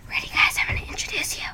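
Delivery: soft unvoiced whisper. Real, not acted. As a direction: whisper it secretly. Whispered introduction to her freckles.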